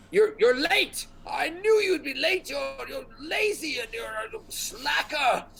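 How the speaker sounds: very high, stammering, frantic rant; flustered scolding wizard. Acted. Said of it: "he looks, he's like".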